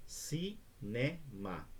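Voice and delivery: "Cinema" very slow, three separate syllables. Shown as a demonstration. Teacher demonstrating a three-syllable word, syllable by syllable. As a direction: say "Cinema" as ci, ne, ma with pauses. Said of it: "três sílabas".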